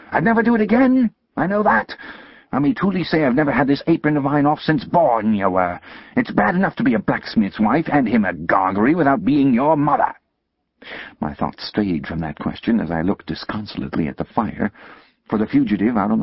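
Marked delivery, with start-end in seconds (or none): 0.1-10.1 higher, loud shrewish scolding; scolding sister. Acted. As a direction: berate the boy like a furious older sister. Sister rants at Pip.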